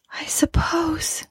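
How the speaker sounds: high, slow, whispery voice; faint and drawn out, worn away; weary ghost. Acted. Dead wife answers her husband, wearily. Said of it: "she said wearily".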